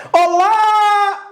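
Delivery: high, sustained call on "lá"; calling out the syllable. Shown as a demonstration. Teacher repeating the tonic syllable for the class, calling out. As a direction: holler and hold the final vowel. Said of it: "Demora mais tempo sendo chamada".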